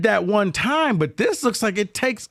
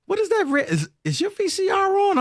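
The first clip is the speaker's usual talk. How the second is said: baffled friend. Retold or imitated. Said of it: "He was like".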